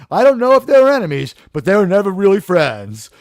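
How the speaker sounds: ordinary delivery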